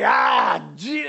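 put-on high, loud drunken yelling; hammered guy. Drunk stranger yelling at the tract giver, hammed-up. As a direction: yell like a rowdy drunk. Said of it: "this guy just completely hammers".

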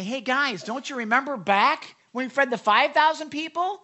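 high, loud, insistent reminder; Jesus prompting disciples. Acted. Preacher as Jesus quizzes his disciples, pointedly prompting. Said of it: "Jesus is gonna say".

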